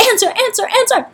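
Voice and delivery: high, squawky repeats; repeated crow shrieks. A narrator's reading. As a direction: keep screeching like a parrot. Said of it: "Steve shrieked".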